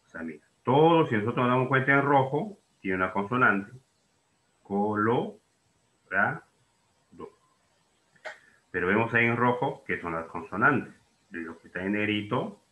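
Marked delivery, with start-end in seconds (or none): 4.6-7.3 "Colo, ra, do" with long pauses between the parts; spaced-out syllable demonstration; split into syllables. Shown as a demonstration. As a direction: break the word apart, piece by piece. Teacher splits word into syllables.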